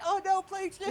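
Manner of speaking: high-pitched cry of recognition; mock alarm. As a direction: call it out high in mock panic. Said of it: "so he's like".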